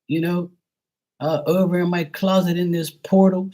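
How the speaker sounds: mocking a medium who brags about her closet portal. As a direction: parody her breathless portal claims. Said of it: "And she'd be like".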